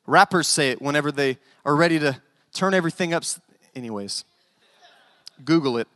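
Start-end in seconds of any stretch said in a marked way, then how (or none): none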